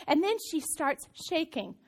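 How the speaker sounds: usual speaking voice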